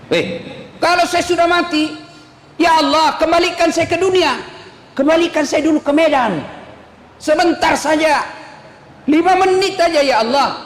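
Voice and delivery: high, pleading voice of a dead man begging God. Acted. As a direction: plead to go back, voice pushed up high.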